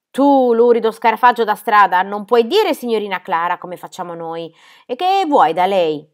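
loud, scornful telling-off; indignant scolding. A narrator's reading. Sebastiano insulting the ragged boy at the door, indignant. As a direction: berate him loudly with contempt.

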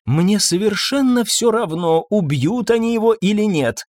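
mild put-upon grumble; grumbling. A narrator's reading. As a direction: complain with weary irritation. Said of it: "ворчала Ирен".

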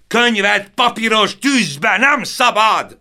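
full-throated roar of despair. A narrator's reading. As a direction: bellow it over the fire, NEM hit hardest. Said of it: "ordította Stern István harmadszor is".

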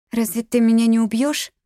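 put-on high-pitched child's question; little girl. A narrator's reading. Captive little girl asking her kidnapper, high child voice.

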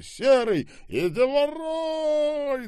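very high, drawn-out scream. A narrator's reading. Скоба pulling the trigger on Миколу, screaming. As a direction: wail it loud and long. Скоба yells while firing machine gun.

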